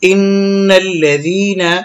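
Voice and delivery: slow chant with hummed n and long vowel; Quran recitation. Shown as a demonstration. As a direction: recite slowly by tajweed rules. Recites the phrase just spelled out.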